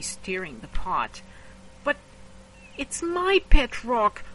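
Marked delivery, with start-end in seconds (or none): 1.8-4.2 put-on whiny kid. Kid whining in story.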